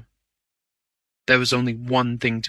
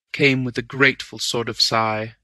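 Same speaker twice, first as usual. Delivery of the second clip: ordinary delivery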